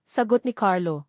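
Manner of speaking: plain reading voice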